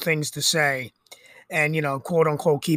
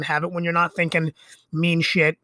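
ordinary talk, nothing marked

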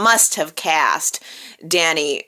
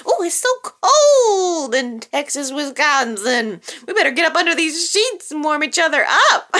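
higher, playful pretend lovers, laughing at the end; mock flirty couple; 7 semitones higher than usual. Vlogger voicing Chance and Abby sneaking under the sheets, teasing and higher. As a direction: ham up a cozy bedroom come-on.

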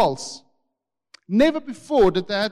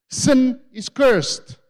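every word slow and louder; slow emphasis; 5 semitones higher, 6 dB louder, much slower than usual. Real, not acted. Preacher drives home to the congregation, slow and emphatic.

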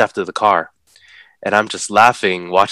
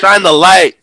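high, loud urgent shout; retold yell; 10 semitones higher, 10 dB louder than usual. Yelling at his cousin to aim the flashlight, a high loud shout. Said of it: "we're yelling at my cousin".

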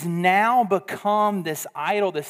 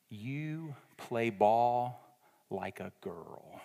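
much softer, slow and lower, dramatic hush; hushed, slow, lower taunt; ultimate insult; 6 semitones lower, 11 dB quieter, much slower than usual. Retold or imitated. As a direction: let the killer line land quietly. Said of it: "he unleashed the ultimate".

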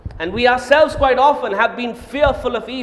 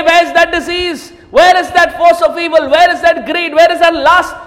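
much louder, higher, shouting; triumphant taunt; 6 semitones higher, 9 dB louder than usual. Acted. Preacher taunting the vanished forces of evil, shouting. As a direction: search mockingly for evil.

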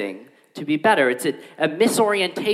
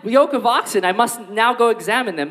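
laughing through the excuse, higher and louder; mocking excuse; 7 semitones higher, 3 dB louder than usual. Preacher mocking the oxen excuse, laughing. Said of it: "Or the".

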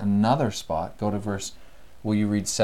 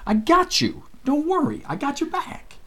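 casual reassurance. Retold or imitated. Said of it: "in essence they say".

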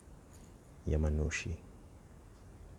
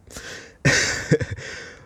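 laughing; 5 dB louder than usual. Real, not acted. Laughs at Trump shout-out.

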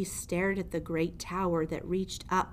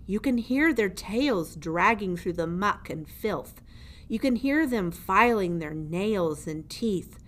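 louder, looming jailer voice taunting a mouse; menacing old jailer; 4 dB louder than usual. Acted. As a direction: lean over the prisoner and gloat. Gregory describes the rats.

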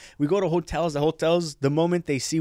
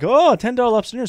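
higher, louder, fast and thrilled; excited echo; 10 semitones higher, 6 dB louder, faster than usual. Retold or imitated. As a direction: repeat it fast like you can't believe the bargain. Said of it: "And I'm like".

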